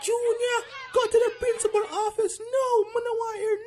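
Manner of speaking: teacher losing control, high and loud; shrill class-calming yell. Acted. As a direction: shout it high over the laughing class.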